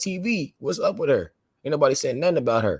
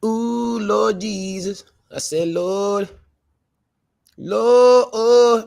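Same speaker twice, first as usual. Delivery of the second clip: wailing lament; 10 semitones higher, 5 dB louder, much slower than usual. Real, not acted. Lamenting prisoner news.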